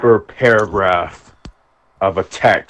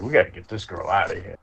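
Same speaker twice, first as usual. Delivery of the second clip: hushed urgent aside; 3 semitones lower, 9 dB quieter, faster than usual. Retold or imitated.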